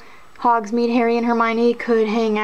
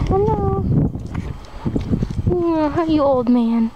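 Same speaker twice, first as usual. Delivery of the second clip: talking to sheep; 4 semitones higher than usual. Real, not acted. Greeting another sheep.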